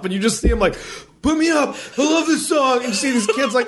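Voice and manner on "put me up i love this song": high-pitched excited begging; mock eager. Big man asking kids to lift him, mock eager. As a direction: imitate a big guy thrilled to crowd surf. Imitating the eager would-be crowd surfer.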